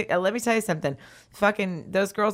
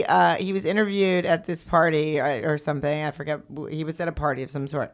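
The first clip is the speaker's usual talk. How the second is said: ordinary talk, nothing marked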